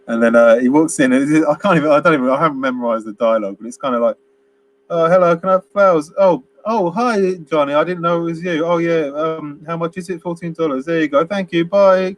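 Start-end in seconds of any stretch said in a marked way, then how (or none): 4.9-12.1 higher, hurried wooden shop chatter; rushed forced acting. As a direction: rattle it off like a bad actor. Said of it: "rushed and forced".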